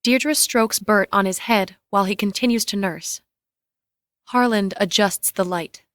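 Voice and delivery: ordinary delivery, nothing marked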